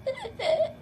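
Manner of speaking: high-pitched giggle breaking up; high laughing squeal. Real, not acted. Laughing fit.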